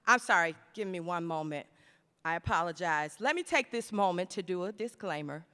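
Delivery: low, soft step out of the speech; apologetic aside. Acted. Speaker pauses her speech for a disclaimer, apologetic aside. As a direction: drop right down, as if excusing an interruption.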